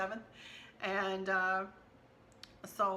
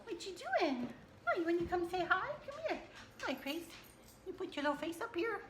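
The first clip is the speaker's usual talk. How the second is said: high sing-song pet voice, softer; talking to the dog; 9 semitones higher, 4 dB quieter, faster than usual. Real, not acted. Vlogger greeting her little dog Gracie in high pet talk. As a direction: coo at the puppy.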